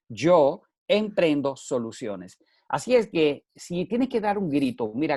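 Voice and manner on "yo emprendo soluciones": slow, word by word, stress on "emprendo"; slow emphatic. Shown as a demonstration. Speaker repeating his motto slowly to listeners. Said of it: "Ahí están las siglas".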